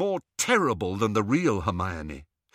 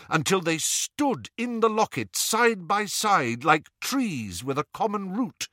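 ordinary delivery, nothing marked

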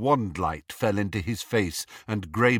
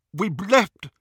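anxious protest; 12 semitones higher, 6 dB louder than usual. A narrator's reading. Injured Ron protests to captors.

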